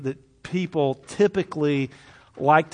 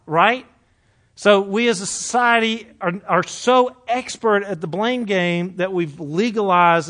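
louder, rising preacher's drive; preaching; 5 semitones higher, 6 dB louder, slower than usual. Acted. Pastor driving home the blame game, louder. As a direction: build it up like a sermon.

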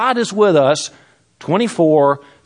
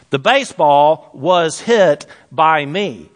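slow example sentence; slower than usual. Shown as a demonstration. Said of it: "That's passive voice".

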